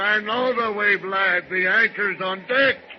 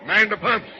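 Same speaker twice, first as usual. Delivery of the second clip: higher, louder command; shouted order; 4 semitones higher, 5 dB louder than usual. Acted. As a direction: bark the order over the storm. Captain orders crew in emergency.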